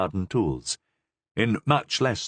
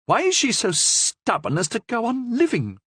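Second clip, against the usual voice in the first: higher, irritated Superior voice; exasperated nun; 14 semitones higher than usual. A narrator's reading. Superior grumbling to the Cardinal, higher voice. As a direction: complain coldly about her.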